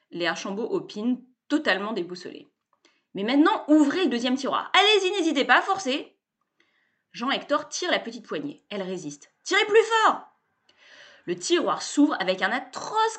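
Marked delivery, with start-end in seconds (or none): 3.1-6.2 higher and louder, pushy glad-handing pitchman; loud, high, cheerful bluster; brash salesman. A narrator's reading. Sébastien's pitch. 9.4-10.2 high loud cry of encouragement; urging shout. A narrator's reading. Urging him to pull.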